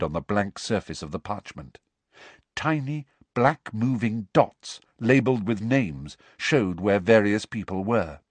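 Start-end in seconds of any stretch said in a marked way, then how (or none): none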